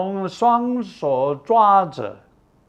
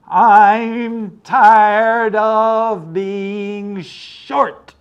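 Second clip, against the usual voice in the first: put-on slow, strained, louder kid's answer; through gritted teeth; 5 semitones higher, 7 dB louder, much slower than usual. Boy answering his mother, slow and strained through clenched teeth. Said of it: "he was gritting his teeth".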